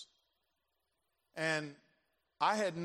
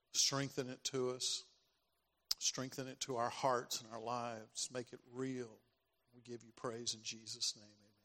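prayer; 3 semitones lower than usual. Real, not acted. Closing prayer.

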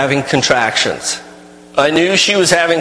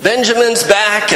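high, bright cries of welcome home; a crowd cheering friends back home; excited welcome; 11 semitones higher than usual. Retold or imitated. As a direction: greet the travellers like a thrilled crowd. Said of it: "everybody's excited".

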